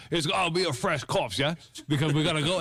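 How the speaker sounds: put-on high, bright Cockney accent. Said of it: "I did a Cockney voice".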